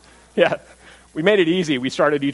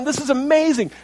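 high, wondering exclamation; amazed crowd; 10 semitones higher than usual. Retold or imitated. Preacher acting the crowd seeing the healing, awed. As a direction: voice the crowd in awe.

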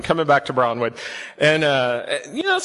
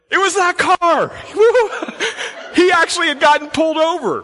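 laughing punchline; 11 semitones higher than usual. Real, not acted. Pastor telling the congregation the tailgater got caught, laughing high. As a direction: laugh out the payoff.

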